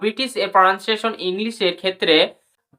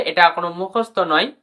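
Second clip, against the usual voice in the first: normal talking voice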